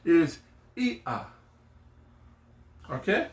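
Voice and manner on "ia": "ia" as ee, slight pause, ah; separated vowels. Shown as a demonstration. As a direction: break "ia" in two with a glottal catch. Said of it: "there's a separation or a slight pause".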